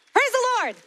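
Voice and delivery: high, loud burst of praise; exuberant praise. Real, not acted. Celebrating twentieth anniversary with the congregation, exuberant praise. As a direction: call it out with sudden delight.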